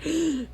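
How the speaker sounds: quick startled intake of breath; sharp gasp. Retold or imitated. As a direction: gasp as if suddenly scared. Acting out a sudden fright.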